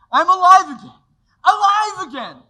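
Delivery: loud, high, overjoyed cry; joyful shout. Acted. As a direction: burst out with relief. George overjoyed to be back.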